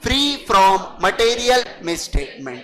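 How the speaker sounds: very slow, word by word; slow dictation. Shown as a demonstration. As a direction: dictate it for students to write. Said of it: "RA जोड़ दूं". (छात्रों को धीरे-धीरे लिखवाना)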